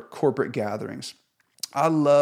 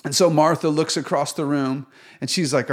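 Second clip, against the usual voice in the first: ordinary talk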